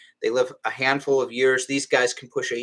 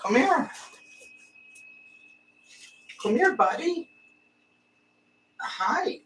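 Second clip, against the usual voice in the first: very high, soft coaxing call; coaxing pet voice; 13 semitones higher, 5 dB quieter than usual. Real, not acted. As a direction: sweet-talk the lizard out. Coaxing lizard out of hiding.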